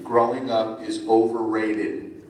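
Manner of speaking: ordinary talk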